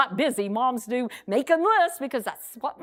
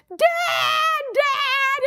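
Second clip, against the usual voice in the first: put-on high-pitched, happy kids calling out; excited shout; 4 dB louder than usual. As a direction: call out to Dad, overjoyed. Kids burst in on dad.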